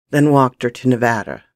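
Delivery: usual speaking voice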